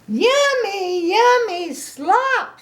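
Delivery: delighted piggy. Acted. Grandma voicing Piggy swooning over her yummy slop. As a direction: savour it like the best treat ever.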